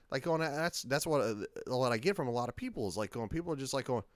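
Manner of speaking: ordinary talk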